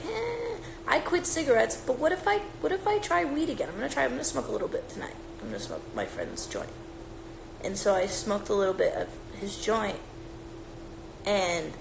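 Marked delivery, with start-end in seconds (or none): 0.1-6.7 lightly voiced stoner inner monologue; a hint of a tempted stoner. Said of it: "I was like".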